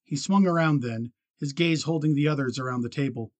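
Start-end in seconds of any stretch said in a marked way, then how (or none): none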